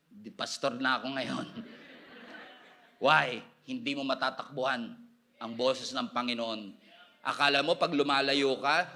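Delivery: ordinary talk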